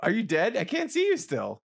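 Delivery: usual speaking voice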